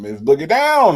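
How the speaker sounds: big welcome. Real, not acted. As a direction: hail the guest with a swell. Name repeated louder as welcome.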